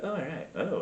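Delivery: softly playacted enjoyment; hushed little oohs; mock pleased murmur. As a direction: murmur as if pleasantly surprised, pretending. Acts out feeling dough.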